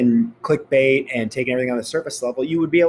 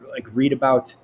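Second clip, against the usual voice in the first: normal talking voice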